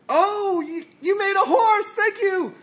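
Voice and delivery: high, bright overdone praise; gushing parent. Retold or imitated. Parent gushing to child over drawing, high excited praise. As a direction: gush over a scribble.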